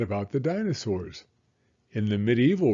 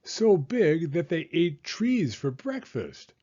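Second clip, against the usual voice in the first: higher-pitched, punchy emphasis on "So big"; emphatic; 6 semitones higher than usual. A narrator's reading. Beaver describing huge tree-eating dinosaurs to Franklin, emphatic on "big". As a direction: impress them with how "big" they are. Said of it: "she emphasized".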